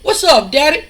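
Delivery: high, loud cheeky call to his dad; cocky greeting. Retold or imitated. Son greets his father in the ring, cheeky and high. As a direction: toss it up brightly with a rising end. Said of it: "And he's like".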